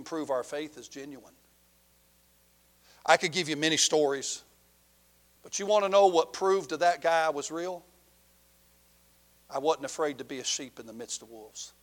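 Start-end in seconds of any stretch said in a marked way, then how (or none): none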